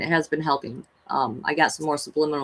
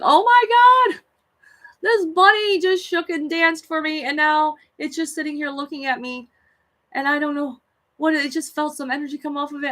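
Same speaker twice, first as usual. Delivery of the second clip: much higher, louder, breathless amazement; excited squeal; 10 semitones higher, 4 dB louder than usual. Retold or imitated. Telling her friend on the phone the bunny danced, squealing high and loud. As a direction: gush like you can't believe it.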